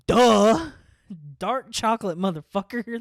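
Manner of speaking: high, loud, exaggerated "duh" then "dark chocolate"; mock obvious duh. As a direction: spell out the obvious, loud and sassy. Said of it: "was like".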